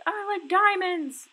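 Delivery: imitating child. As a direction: squeak it like a little kid. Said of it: "students usually like".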